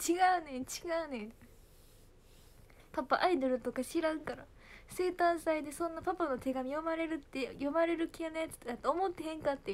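high, protesting Kansai-dialect retelling; dad's excuse. Retold or imitated. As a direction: plead your case, flustered, as the teased father. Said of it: "パパはいまだにいじってる". (弁解する父親の関西弁)

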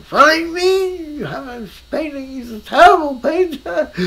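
high, loud outraged teacher; indignant outburst. Retold or imitated. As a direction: blurt it out in disbelief, like a fit. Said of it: "Albers almost had a fit".

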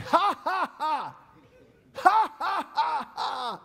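loud, high hammed-up laughing; mock laughter. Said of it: "you gonna think".

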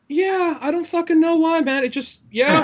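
high-pitched, helpless exasperation; acted-out clueless protest, pitched high; mock exasperation. Answering the Russian roommate's grammar question, mock exasperation. Acting out his flustered answer.

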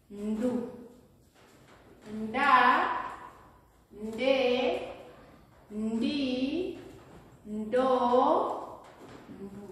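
"Nda" to "Ndu" one by one, n before each; teacher reading a syllable chart aloud; "Nda", "Nde", "Ndi", "Ndo", "Ndu" spaced out clearly; syllable drill. Shown as a demonstration. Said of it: "when we add N".

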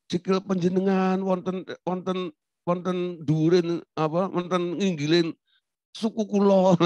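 mock-polite Javanese, pitch held up; refined Javanese politeness. Javanese telling someone they're on their foot, overly polite. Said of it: "tapi omongannya halus".

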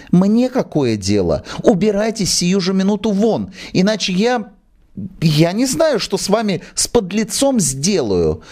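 high, rising outraged threat; furious actress. Acted. Actress orders the manager out in a fury.

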